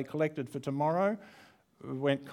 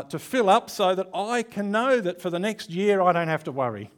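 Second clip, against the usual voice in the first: mock greedy prayer; 6 semitones higher, 6 dB louder than usual. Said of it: "this is how I'd like to pray".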